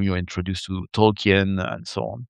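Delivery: ordinary talk, nothing marked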